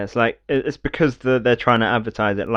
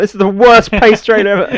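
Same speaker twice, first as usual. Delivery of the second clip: high, loud disbelief; exasperated outburst; 11 semitones higher, 10 dB louder than usual. Acted. Viewer fed up with the Scalebound trailer, exasperated outburst.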